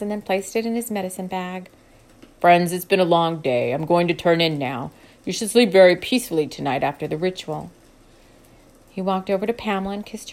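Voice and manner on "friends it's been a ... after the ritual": put-on lower, louder old healer bidding goodnight; a wise elder turning in for the night; Edgar's calm deep voice. A narrator's reading.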